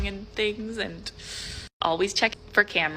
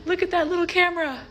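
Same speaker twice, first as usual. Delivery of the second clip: 1 shocked discovery; 10 semitones higher, faster than usual. Real, not acted. Discovering hidden camera.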